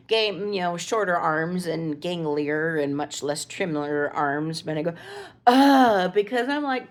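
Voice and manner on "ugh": loud, high exasperated "ugh" thrown back; mimicked groan. Mom mimicking her son's groan back at him. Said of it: "when I just mimicked him perfectly".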